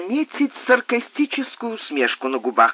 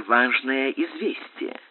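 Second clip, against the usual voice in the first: plain reading voice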